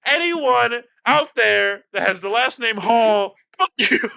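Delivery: high, loud outraged yelling; ranting at chat. Real, not acted. Player blames chat for bad advice, furious ranting. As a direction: yell it like a sore loser. Rages at chat after losing.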